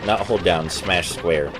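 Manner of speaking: normal talking voice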